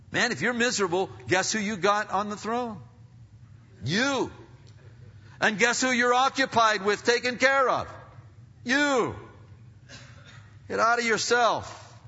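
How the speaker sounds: loud, high confrontational preaching; full-force preaching. Real, not acted. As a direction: drive the challenge home at full volume.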